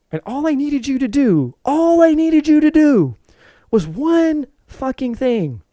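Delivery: high, slow, emphatic frustration; exasperated husband. Retold or imitated. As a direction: drag it out, every word heavy with annoyance.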